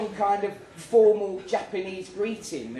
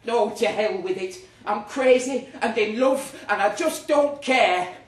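higher, louder giddy outburst; giddy in love; 6 semitones higher, 8 dB louder than usual. Acted. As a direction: burst out like a lovestruck fool.

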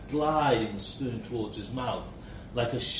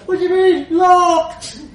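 much higher, much louder shrieked question; shrieking disbelief; 13 dB louder than usual. Acted. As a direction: keep shrieking from inside the case. Trapped man shrieks on.

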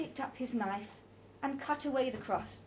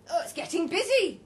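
loud, higher character voice; Roger's loud remark; 7 semitones higher, 8 dB louder than usual. A narrator's reading. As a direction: announce it loudly to the table. Roger remarks in busy restaurant.

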